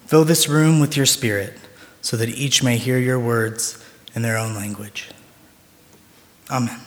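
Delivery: soft, hushed opening prayer. Acted. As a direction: pray quietly to the Lord. Opening prayer before sermon.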